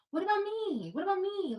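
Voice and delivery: imitating her small daughter begging for attention; whiny little girl. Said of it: "And she's like".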